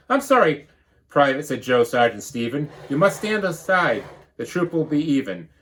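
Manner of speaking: lively storybook read-aloud; bouncy rhyming storybook narration; reading to children. Acted. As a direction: read it like a picture book to the class. Teacher reads picture book.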